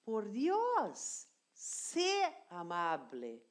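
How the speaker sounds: slow, careful Spanish example; slow demo. Shown as a demonstration. Spanish teacher slowly models the imperative for students. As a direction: spell it out slowly for the learner. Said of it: "eu vou dizer".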